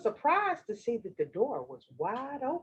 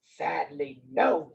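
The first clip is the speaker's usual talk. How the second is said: low, mock-regretful wolf; 10 semitones lower, slower than usual. Wolf confesses to grandmother, low wolf voice. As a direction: admit it in a deep wolf voice. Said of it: "said the wolf".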